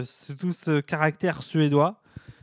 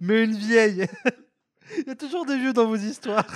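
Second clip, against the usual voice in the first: talking through laughter; giggling while teasing; laughing; 6 semitones higher than usual. Real, not acted.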